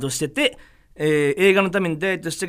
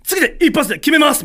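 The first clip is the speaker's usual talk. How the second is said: high, loud re-enacted pledge; fired-up vow; 10 semitones higher, 5 dB louder than usual. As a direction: psych yourself up before the crew. Retold vow to nail it. (気合いを入れた宣言)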